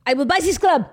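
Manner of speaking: put-on high, loud French-accented boast; mock French accent. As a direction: imitate an indignant Frenchman, with laughter creeping in. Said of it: "He's French".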